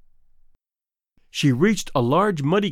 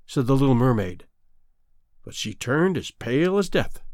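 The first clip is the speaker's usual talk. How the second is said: plain reading voice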